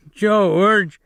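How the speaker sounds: high, loud frightened call for help; terrified cry. Acted. Lennie calling George for help, high frightened cry. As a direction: cry out in terror. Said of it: "he cried".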